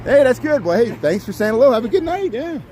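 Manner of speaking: bright, high call to a passing fan; hey there, big friendly send-off; cheery greeting. Real, not acted. Vlogger thanking a fan who said hello, cheerfully high.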